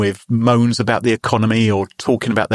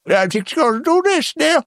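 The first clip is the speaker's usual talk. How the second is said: mock scammer voice. Mimics pushy scammer on the phone.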